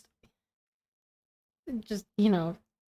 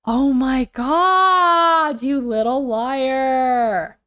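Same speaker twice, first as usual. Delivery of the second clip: drawn-out, loud, teasing accusation; mock outrage; 2 semitones higher, 4 dB louder, much slower than usual. Real, not acted. Calling out a lying partner, mock outrage. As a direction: stretch it out in playful indignation.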